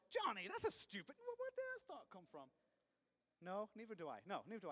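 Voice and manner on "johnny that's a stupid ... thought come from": louder, rising to a high shocked squeak; startled self-scolding. Retold or imitated. Man scolds his own dark thought, shocked and rising. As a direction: catch yourself, appalled. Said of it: "And then you go".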